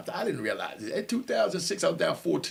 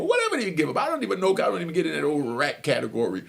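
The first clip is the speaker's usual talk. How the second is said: ordinary talk, nothing marked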